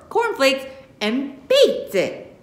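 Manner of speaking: high, slow, outraged complaint; outraged disbelief. Acted. Grandma complaining about her catfish, outraged. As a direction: drag out the outrage.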